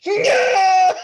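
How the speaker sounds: high, loud yell; battle cry. Acted. Silouan leaping with a glaive, a loud battle cry. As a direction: shout it as you leap.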